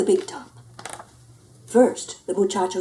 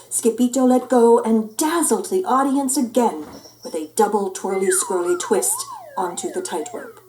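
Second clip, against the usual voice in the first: ordinary delivery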